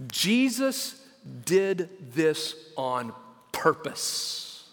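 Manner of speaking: slow and deliberate. Real, not acted. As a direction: land the key point word by word. Slow key point to congregation.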